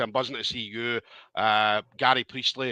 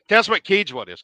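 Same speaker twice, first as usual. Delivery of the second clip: louder repeat; 6 semitones higher, 6 dB louder than usual. Real, not acted. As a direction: question asked again, louder and higher. Host repeats the question louder.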